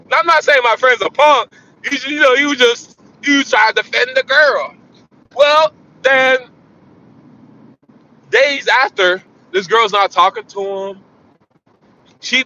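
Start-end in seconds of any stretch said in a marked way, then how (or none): none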